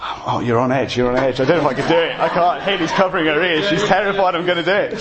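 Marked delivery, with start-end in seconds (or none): none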